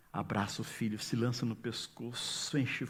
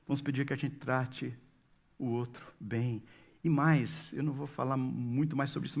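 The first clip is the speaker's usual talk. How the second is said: ordinary talk, nothing marked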